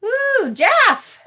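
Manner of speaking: high-pitched excited whoop; excited greeting. Real, not acted. Reader spots a viewer joining, excited high greeting. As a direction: greet them with a delighted, swooping high cry.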